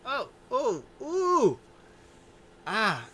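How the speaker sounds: high, loud mock cries of pain; hammed-up injury yelps. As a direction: yelp like a pulled leg. Leg starts hurting on ramp.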